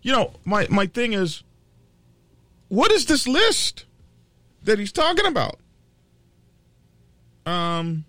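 high-pitched frustrated rant about the list; exasperated. Real, not acted. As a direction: voice climbing in disbelief.

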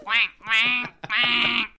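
loud quacking like a duck. Pretend. Imitating a duck in reply.

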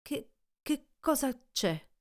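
Montgomery noticing the injured arm; worried question. Acted. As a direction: stammer out a concerned question. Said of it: "mi chiese".

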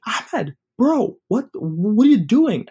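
high, loud, slow disbelief; exasperated cousin. Retold or imitated. Cousin scolding after drop.

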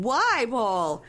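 mock whine with a pinched nose; holding her nose. Wife at the poop water facility asking Paul why, pinched-nose whine. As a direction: wail it as if holding your nose. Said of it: "holding her nose going".

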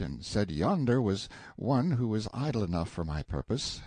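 ordinary delivery

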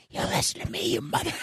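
voiceless whispered threat; mock threatening whisper. Caller leaving an untraceable angry message, whispering. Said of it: "anyone that whispers on a line".

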